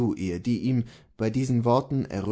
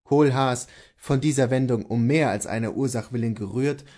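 usual speaking voice

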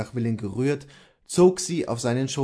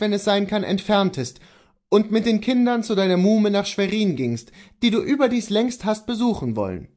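insistent, higher-pitched husband; Kohlhaas the husband; 10 semitones higher, 4 dB louder than usual. Acted. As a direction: urge your wife to leave for a while.

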